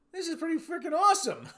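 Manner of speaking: high, loud gush breaking into laughter; mock amazement. Traveler admitting England beats revolutionary America, amazed and laughing. Acts out his delight at visiting England.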